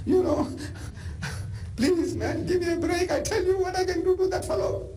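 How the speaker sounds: very high, urgent, near crying out; hammed-up excited shepherd boy; eager boy pleading. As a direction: beg to be let fight. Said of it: "He says".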